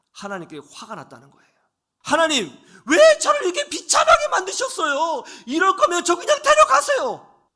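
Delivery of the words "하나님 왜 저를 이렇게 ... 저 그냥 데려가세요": shouting at God, pitch far up, much louder; desperate anguished cry; angry prayer. Retold or imitated. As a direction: hurl the complaint at heaven. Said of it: "원망의 기도를 쏟아냈습니다".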